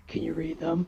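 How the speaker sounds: put-on soft old man voice, slightly low; disguised old man. Caller asking Applebee's about appetizers, disguised as an old man. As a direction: put on a hushed old man's voice. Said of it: "disguise my voice as an old man".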